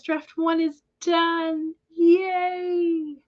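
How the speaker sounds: high, slow, drawn-out celebration; excited cheer. Real, not acted. Streamer celebrating Nina's finished first draft, high slow cheer. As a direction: savour the news and cheer it.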